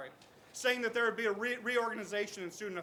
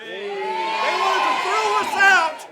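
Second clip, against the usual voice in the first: slow, much louder shout to the crowd; rally shout; 7 semitones higher, 14 dB louder, much slower than usual. Real, not acted. Student speaker shouting to the protest crowd, slow booming shout.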